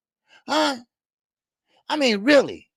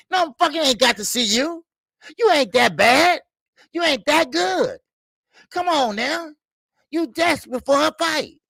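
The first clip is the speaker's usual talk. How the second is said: raised, higher pitched tirade; angry higher yelling at the boxer; heated rant; 6 semitones higher than usual. Real, not acted. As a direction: go off on him, voice climbing. Yelling down at the fighter.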